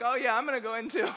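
fast, high, joking mock eagerness with a laugh; pretending to jump in. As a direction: play along as if about to dive in, laughing. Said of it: "I'm, like, pretending like".

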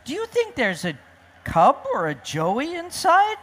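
high, hopeful question; eager storybook animal asking; hopeful wondering. Acted. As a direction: wonder aloud, pitched well up. Animals wonder about the van.

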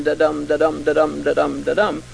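low, even da-DUM beats, stress on every second syllable; iambic da-dum rhythm. Shown as a demonstration. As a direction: tap out the meter, da-DUM da-DUM. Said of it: "ten-syllable iambic pentameter line".

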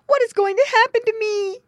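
very high, loud frightened squeal; a caught piglet pleading; terrified piglet. A narrator's reading. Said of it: "squealed Squinty".

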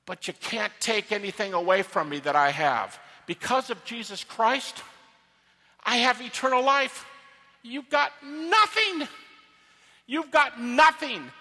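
building to a high, loud jeer; defiant taunt. Shown as a demonstration. Paul taunting death because he has eternal life, loudly defiant. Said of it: "he's taunting death".

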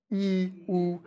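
sustained ee for "E", then a long "oo"; vowel contrast demo. Shown as a demonstration. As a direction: say "E, oo" slowly as isolated model vowels. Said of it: "Don't be afraid to say it".

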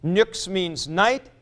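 normal talking voice

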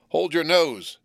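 terrible cry. A narrator's reading. Convict startles the boy in the churchyard, a terrible cry. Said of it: "cried a terrible voice".

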